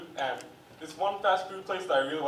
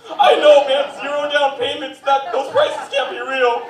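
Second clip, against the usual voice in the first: loud, higher mock anguish with laughs; hammed-up moviegoer; 11 semitones higher, 14 dB louder, slower than usual. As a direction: fake devastation over truck prices.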